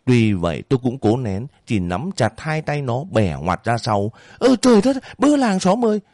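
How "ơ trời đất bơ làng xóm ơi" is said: landlady shrieking for the neighbours; calling for help. Acted. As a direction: wail out for the whole village.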